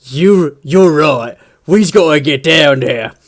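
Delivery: loud orc agreement; a boss giving in, still bellowing; agreeing war boss. Retold or imitated. War boss agreeing with his orc, loud orc voice. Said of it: "the war boss is like".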